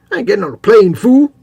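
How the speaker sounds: loud, high hammed-up tough-guy bark; mock tough guy. Joking to co-host about a plane, as a hammed-up tough guy. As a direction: shout it like a gruff TV tough guy, played for laughs. Quoting Mr T line.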